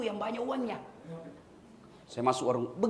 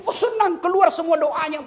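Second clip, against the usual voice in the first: much higher and louder, delighted; joyful poor man; 9 semitones higher, 8 dB louder than usual. Retold or imitated. Preacher voicing the poor man's delight at the gift, high and loud. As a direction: burst out with happy thanks.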